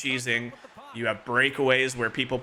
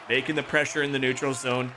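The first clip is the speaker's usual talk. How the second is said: ordinary delivery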